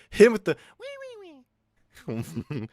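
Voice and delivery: high squeaky teasing voice; squeaky mocking.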